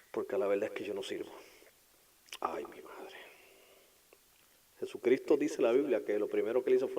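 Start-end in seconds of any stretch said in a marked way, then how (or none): 2.4-3.2 hushed dismay. Acted. Peter's dismay, quiet.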